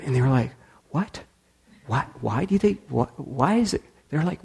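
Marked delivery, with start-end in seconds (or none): 0.9-3.8 high, baffled stammering questions; puzzled. Retold or imitated. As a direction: sound thrown and confused. Diners puzzled by paid meal.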